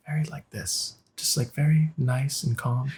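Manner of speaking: imitating a soft, soothing talker; soothing, calm. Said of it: "Talks, like".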